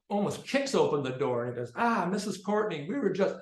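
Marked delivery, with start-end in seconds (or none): 1.8-3.4 flustered greeting. Retold or imitated. Teacher greets the angry mother.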